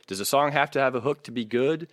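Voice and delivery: ordinary talk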